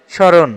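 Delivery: স্মরণ said as "sho-ron"; model pronunciation. Shown as a demonstration. Teacher answers the quiz on স্মরণ, modelling "sho-ron" without m. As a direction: leave the m silent in স্মরণ. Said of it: "স্মরণ শব্দের সঠিক উচ্চারণ হচ্ছে".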